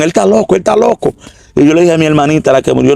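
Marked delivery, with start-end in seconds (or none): 0.0-1.1 worked-up retelling. Retold or imitated. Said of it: "dando gritos locos y dijeron".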